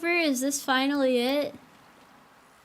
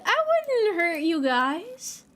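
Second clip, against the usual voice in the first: slow, higher mock soothing voice; mock reassuring; 5 semitones higher than usual. Shown as a demonstration. Telling uneasy chat she won't hurt them, mock soothing. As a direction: reassure them slowly, a little too sweetly. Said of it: "sounds like I'm getting ready to hurt you".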